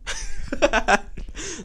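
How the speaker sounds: high burst of laughter. Real, not acted. Laughing at the couple's offer, high giggle.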